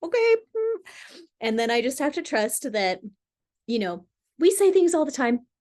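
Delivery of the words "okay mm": much higher, a bit louder, rising; meek okay. Retold or imitated. [name] answering her inner voice, high meek. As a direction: squeak obediently. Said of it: "then I'm like".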